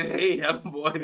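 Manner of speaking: put-on light German-accented coach voice; a quick mock of the manager's accent; understated Klopp-style German accent; Klopp impression. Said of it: "did you hear this guy's voice".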